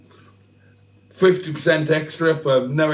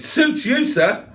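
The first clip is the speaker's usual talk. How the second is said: suits you sir; 8 semitones higher, slower than usual. Retold or imitated. Quoting a comedy catchphrase.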